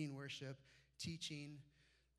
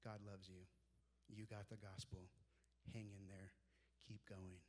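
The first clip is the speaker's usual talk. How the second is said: tender encouragement; 6 semitones lower, 10 dB quieter than usual. Retold or imitated. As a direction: lower and softer, an old man's blessing. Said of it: "A man three times my age going".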